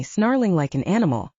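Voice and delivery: plain reading voice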